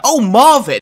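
high, loud sudden remembering; oh no, forgot him; sudden realization. Real, not acted. Streamer suddenly remembering his friend Marvin, alarmed.

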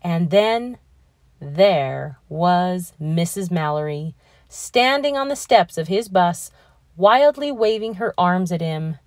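ordinary delivery, nothing marked

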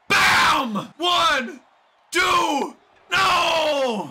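high, loud count ending in an anguished no; hyped count turning to outraged disbelief; excited pin count. Real, not acted. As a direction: shout each number, then wail the no. Counting a pinfall, then denied.

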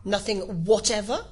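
insistent King, rising on "whatever"; persistent questioning. A narrator's reading. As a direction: press the witness with disbelief. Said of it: "persisted the King".

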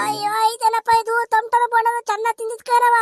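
high, mounting bellyache panic; straining in discomfort. Acted. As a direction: fight to hold in gas and lose. Gassy old woman straining to hold it.

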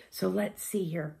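usual speaking voice

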